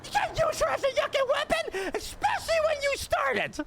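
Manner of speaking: high shouted rant; mock outrage. Comedian shouting at co-host about using mom. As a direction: tear into him.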